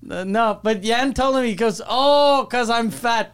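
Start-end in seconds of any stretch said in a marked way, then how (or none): 1.9-3.3 higher, louder mock French accent; fake French accent. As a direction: imitate a friend's dawning realisation, French-accented. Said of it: "in his fucking fake French accent".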